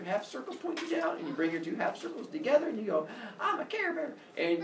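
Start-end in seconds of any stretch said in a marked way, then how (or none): 3.4-4.1 high-pitched mock-cute squeak; cutesy Care Bear. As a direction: pitch it way up, playfully sweet. Mock cutesy line for hand sign.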